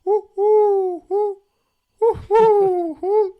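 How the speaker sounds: high, loud owl-like woo calls; stand-up bit doing a loud high bird call; pigeon call imitation. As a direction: hoot like a bird outside the window. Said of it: "How about if I did this?".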